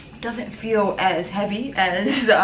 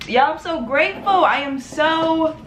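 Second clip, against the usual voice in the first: real crying thanks, higher and louder; grateful words pushed through tears; tearful gratitude; 7 semitones higher, 4 dB louder than usual. Real, not acted. Thanking viewers for their support, crying.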